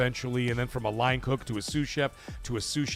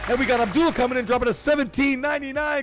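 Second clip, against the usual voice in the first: hype announcer; 13 semitones higher, 7 dB louder than usual. Acted. Hyping a donation from Qatar.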